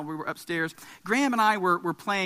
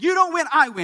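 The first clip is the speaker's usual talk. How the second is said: put-on high, loud indignant kid; imitated childish defiance; enraged child; 8 semitones higher, 7 dB louder than usual. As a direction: repeat the claim like a defiant little boy. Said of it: "Grady is just becoming enraged".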